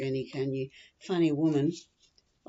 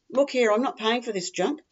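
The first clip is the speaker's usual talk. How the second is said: higher, louder, indignant protest; indignant complaint; 6 semitones higher, 5 dB louder than usual. Acted. Customer returning bad glasses to the shop, indignant complaint. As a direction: take a firm stand with the shop.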